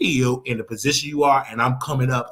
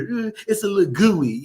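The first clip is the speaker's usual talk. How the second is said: squeaky higher mock voice on "it's a little gooey"; mock high excuse; 7 semitones higher than usual. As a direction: ham up his silly excuse, pitched up. Mocking a man's excuse.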